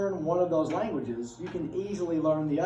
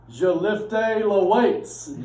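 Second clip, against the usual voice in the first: slow, loud mock French; English words dressed up as French; fake French accent; 4 semitones higher, 8 dB louder, much slower than usual. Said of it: "I would say an English word, but make it French".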